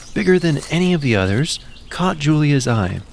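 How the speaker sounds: usual speaking voice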